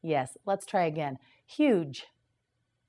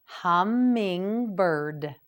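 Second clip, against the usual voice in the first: hummingbird, each syllable drawn out slowly; slow teacher model of hummingbird; slow model word; much slower than usual. Shown as a demonstration. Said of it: "You try it".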